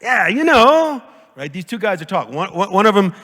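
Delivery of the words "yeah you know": mock Italian talk. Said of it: "they're having that kind of talk".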